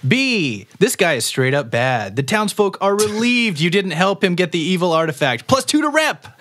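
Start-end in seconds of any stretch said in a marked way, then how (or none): 5.5-6.4 high, laughing reward call; excited announcement. Acted. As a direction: announce it with glee.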